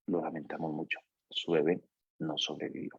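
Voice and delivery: hushed, grave delivery of bad news; a doctor softly telling a father his baby died; somber quiet news. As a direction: break the news gently and softly.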